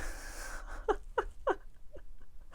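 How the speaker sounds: high, soft breathy giggle; real quiet laugh bubbling up. Real, not acted. Card reader amused by what turns up, laughing. Amused while checking the cards.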